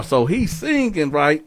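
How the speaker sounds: normal talking voice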